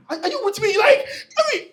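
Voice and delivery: amused preacher cracking up; laughing. Real, not acted. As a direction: laugh while asking. Laughing at own story.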